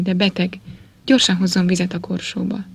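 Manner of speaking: plain reading voice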